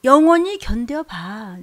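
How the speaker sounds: slow, loud taunting rebuke; stern rebuke. Acted. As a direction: dare the sinner, slow and loud. 죄인에게 꾸짖음. (천천히 크게 꾸짖기)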